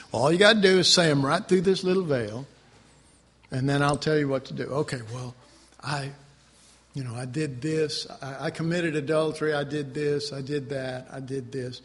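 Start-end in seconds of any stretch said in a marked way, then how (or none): 4.6-11.8 low, soft sheepish confession; mock mumbled confession. Believer confessing to a priest, low and mumbled. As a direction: mumble the sins away.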